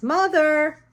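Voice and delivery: high, loud call up the stairs; mock call for a mother who isn't home; calling upstairs. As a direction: shout it up the stairwell.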